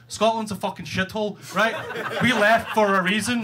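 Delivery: normal talking voice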